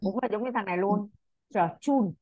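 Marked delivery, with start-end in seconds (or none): 1.5-2.1 "Chờ, chun" with a clear ch at the start of both words; correcting the pupil: "chun" begins with ch; correct ch sound. Shown as a demonstration. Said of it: "Cái đọc là chờ mà".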